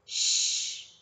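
unvoiced sighing shush; sighed shh. Lily's mother sighs shh to the piglets. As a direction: breathe out a tired shh. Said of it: "sighed Lily's mother".

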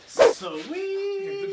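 high loud drawn-out cheer; excited shout. Real, not acted. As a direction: yell it in delight. Excited reaction exclamation.

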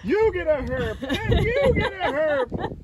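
game show giveaway. Pretend. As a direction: announce it like a giveaway host. Excited giveaway announcement.

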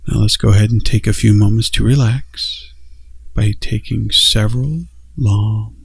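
gentle meditation guide growing hushed; guided meditation. Acted.

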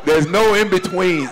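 usual speaking voice

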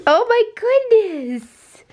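high, drawn-out gushing reaction; amazed exclamation. Real, not acted. Playful amazement at child's list.

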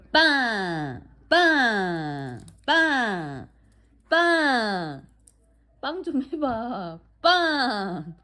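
long, coaxing command; talking to a dog. Real, not acted. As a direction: coax the dog into the trick. Repeating trick command to dog.

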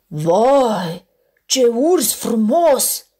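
loud, slow, admiring polar bear; a loud, drawn-out cry of admiration. Acted. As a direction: gush over the handsome bear. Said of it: "strigă un urs alb".

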